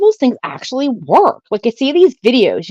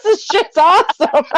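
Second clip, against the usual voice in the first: excited; 11 semitones higher than usual. Retold or imitated. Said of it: "I was like".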